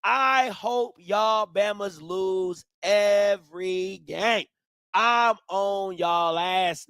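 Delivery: slow, deliberate trash talk; drawn-out menacing tease; slow taunt. Real, not acted. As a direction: lean on every word slowly.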